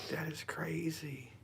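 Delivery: slow hushed whisper, amazed. Real, not acted. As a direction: whisper it again, still stunned. Staring at shadow in dark.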